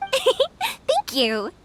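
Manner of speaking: a bright laugh spilling into thanks; laugh, then thank them with delight. Acted. Young helper thanking a kind villager, laughing. Cheerful thanks after warm welcome.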